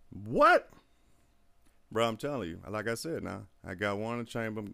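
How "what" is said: high, loud disbelief; shocked what. Real, not acted. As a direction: blurt it out floored. Shocked by gun law claim.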